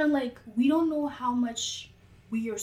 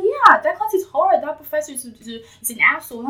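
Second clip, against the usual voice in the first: higher, louder complaint about the professor; exasperated classmate; 5 semitones higher, 9 dB louder than usual. Retold or imitated. Classmate griping about the professor, exasperated. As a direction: vent about a hard class. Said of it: "She's like".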